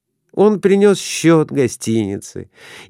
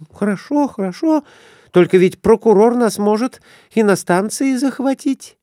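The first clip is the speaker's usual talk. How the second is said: high anxious woman's voice; worried wife; 11 semitones higher than usual. A narrator's reading. Wife nervously answering her husband about the prosecutor. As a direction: fret over the prosecutor, voice raised high.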